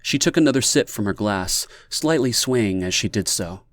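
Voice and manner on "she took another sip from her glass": usual speaking voice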